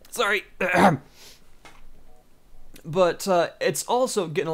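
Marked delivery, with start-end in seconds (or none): 0.5-1.0 single loud cough. Real, not acted. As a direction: cough once, loudly. Sneezing fit after warning.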